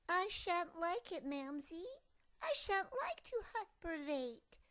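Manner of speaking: high, loud stubborn cub. Acted. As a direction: stomp the words out. Cub stomping, refusing.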